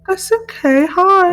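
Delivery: put-on chirpy little kid greeting. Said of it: "the little baby voice".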